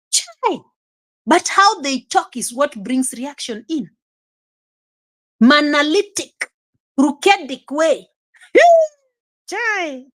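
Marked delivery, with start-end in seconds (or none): 0.1-0.6 a sharp, high "Chai"; high dismayed exclamation. Real, not acted. Exclaims after defending himself. 8.5-10.2 a high "Chai" broken by laughter; laughing exclamation. Real, not acted.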